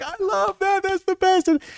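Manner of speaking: very high squealed gushing; delighted crowd. Retold or imitated. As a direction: imitate a table cracking up with delight. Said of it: "They're like".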